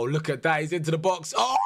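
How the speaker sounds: normal talking voice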